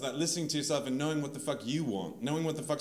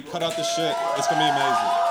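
shouted finale; 10 dB louder than usual. Real, not acted.

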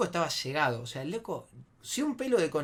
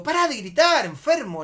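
high, loud telling-off; retold loud scolding; exasperated shout; 9 semitones higher, 10 dB louder than usual. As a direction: snap back over someone's yelling.

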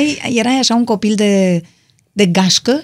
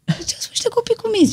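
much higher, softer, mock-impressed; teasing irony; 14 semitones higher, 5 dB quieter than usual. Real, not acted. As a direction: tease them like you don't quite believe it. Teasing two well-behaved guests.

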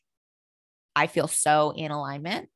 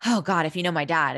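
ordinary talk, nothing marked

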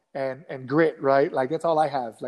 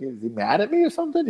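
higher, questioning tone; puzzled suspicion; 4 semitones higher than usual. Retold or imitated. Newcomer wondering if he's mad, puzzled suspicion. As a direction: voice a confused listener, pitch climbing into the question. Puzzled listener misreading a compliment.

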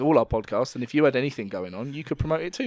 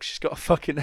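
ordinary talk

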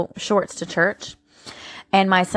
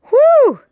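a loud, high exhale of awe; loud high whew; 11 dB louder than usual. Real, not acted. Overwhelmed after deep passage.

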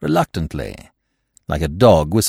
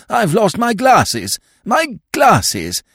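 high, fretful plea; distressed exclamation; 11 semitones higher, 3 dB louder, slower than usual. Acted. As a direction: near panic over lost glasses.

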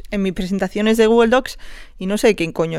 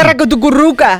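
mock gibberish; 2 semitones higher than usual. Gibberish duel.

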